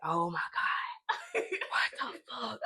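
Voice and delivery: slow stunned disbelief. Retold or imitated. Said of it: "I'm like".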